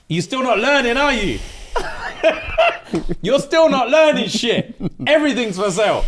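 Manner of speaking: high, loud laughing taunt; mocking laugh. Mister Gage laughing at Demi for not learning, mocking. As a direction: laugh at her as you repeat it.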